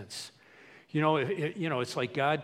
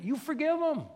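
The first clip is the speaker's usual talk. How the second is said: high-pitched, emphatic insistence; urgent acted admonishing; insistent command; 12 semitones higher than usual. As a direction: lay down the rule, pitched way up. Acts Jesus insisting on forgiveness.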